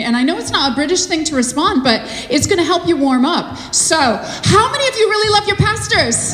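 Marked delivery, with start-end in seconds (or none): 3.7-6.3 higher, louder call to the crowd; loud rallying shout. Shown as a demonstration. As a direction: shout it out to rouse the room. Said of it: "You get really, really vocal".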